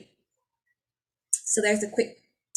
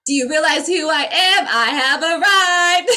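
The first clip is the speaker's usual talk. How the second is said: grand awakened being; 7 semitones higher, 8 dB louder than usual. Pretend. Declaring herself to the world in a lofty, loud voice. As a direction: announce your arrival like a deity. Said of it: "be like".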